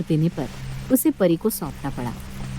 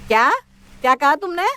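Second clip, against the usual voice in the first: sharp demand; 7 semitones higher than usual. Acted. Mother-in-law demands a repeat.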